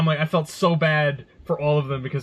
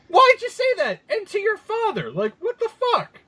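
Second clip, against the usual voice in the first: incredulous. Retold or imitated. Outraged at son's remark.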